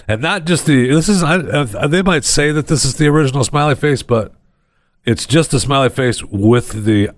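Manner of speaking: ordinary talk, nothing marked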